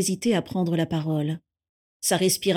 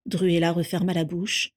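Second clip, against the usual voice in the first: usual speaking voice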